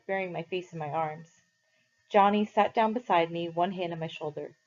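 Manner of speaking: ordinary delivery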